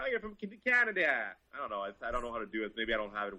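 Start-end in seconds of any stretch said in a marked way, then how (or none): 0.0-1.1 very high-pitched mock Southern accent. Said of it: "can you do his accent at all".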